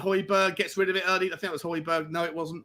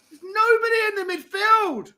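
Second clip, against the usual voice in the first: even higher, near-crying wail; anguished wail; 13 semitones higher than usual. Real, not acted. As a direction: let the voice break in despair. Repeats it, voice cracking.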